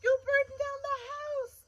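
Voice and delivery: a mother shrieking at her husband; cried out. Acted. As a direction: cry out in high alarm. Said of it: "cried the mother".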